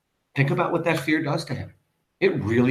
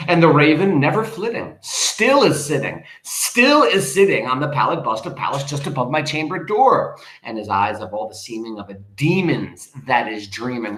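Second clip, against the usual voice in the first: dramatic poem reading; 4 dB louder than usual. Acted. Teacher reading Poe to the class, declaiming. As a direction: read the lines like a stage poet.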